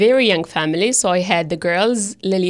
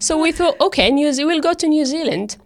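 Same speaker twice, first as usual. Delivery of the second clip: higher, brighter voice telling the decision; a lively, higher lift as she recalls choosing; excited retelling; 9 semitones higher, faster than usual. Retold or imitated. Wife laughing as she tells how they chose New Zealand, higher and excited.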